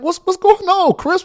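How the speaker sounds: imitating the bishop's surprise. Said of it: "And Bishop was like".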